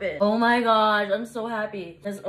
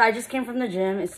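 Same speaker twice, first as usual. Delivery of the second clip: ordinary talk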